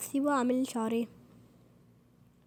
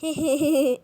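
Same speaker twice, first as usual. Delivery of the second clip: loud happy laugh; burst of laughter; 2 semitones higher, 9 dB louder than usual. Real, not acted. Laugh after success. (ضحكة عالية فرحانة)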